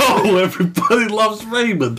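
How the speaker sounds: hammed-up celebrity impression; Ray Romano. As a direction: do a badly faked comedian voice. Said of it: "give me your Ray Romano impression".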